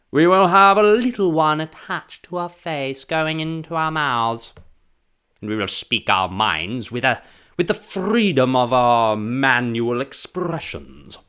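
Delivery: lampoon a stuffy Brit; mock posh British. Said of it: "It has to be like".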